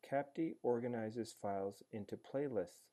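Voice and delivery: ordinary talk, nothing marked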